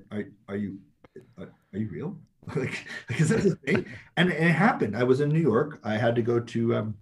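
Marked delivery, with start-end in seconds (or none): 0.1-2.2 incredulous. Retold or imitated. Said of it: "you're like".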